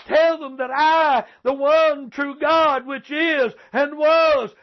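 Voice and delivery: high, stretched-out preacher's cry; impassioned preaching. Real, not acted. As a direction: ring out each phrase from the pulpit. Preacher building to a proclamation.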